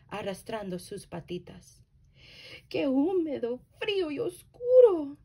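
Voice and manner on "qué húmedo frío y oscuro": higher, louder little mouse voice; squeaky mouse. A narrator's reading. Mouse squeaking in the dark cave, high and loud. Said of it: "dice el ratón mientras chilla".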